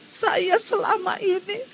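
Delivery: high, tearful voice breaking into sobs; weeping through the words, lightly acted; mother crying on the phone. Retold mother confides to the teacher by phone, crying high and tearful. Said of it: "Langsung mamanya ini nangis".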